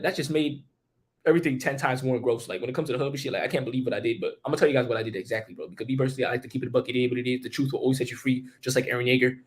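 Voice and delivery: ordinary talk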